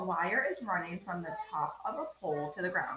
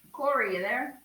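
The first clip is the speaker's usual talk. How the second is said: higher, louder roll-call check; 6 semitones higher, 8 dB louder than usual. Real, not acted. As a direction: call out for a student, rising at the end. Roll call, calling a student.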